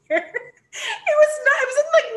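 high, slow words through real laughter; laughing. Real, not acted. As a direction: talk through a fit of laughter. Laughs helplessly at her own story.

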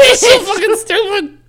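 high laughing, almost crying; laughing hard. Real, not acted. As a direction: crack up mid-sentence. Friend cracking up laughing.

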